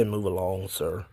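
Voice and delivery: low, soft mock mall cop. Quoting a South Park mall cop, low soft mock voice. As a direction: mumble it like a bored mall cop. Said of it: "a mall cop ... that would say".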